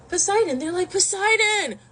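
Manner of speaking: high-pitched playful call; excited calling out. Retold or imitated. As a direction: call out to him, high and inviting. Ethiopians calling to invite Poseidon.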